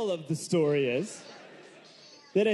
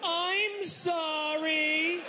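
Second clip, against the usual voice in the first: very high, very slow, stretched-out "I'm sorry"; yelled apology; 12 semitones higher, much slower than usual. Retold or imitated. Apologizing to the doctor mid-exam, a high drawn-out yell. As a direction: wail the apology, dragging every sound. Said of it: "So I yelled".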